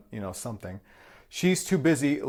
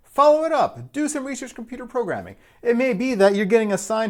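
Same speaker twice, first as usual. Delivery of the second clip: excited urging; 11 semitones higher, 8 dB louder than usual. Real, not acted. Urging viewers to act.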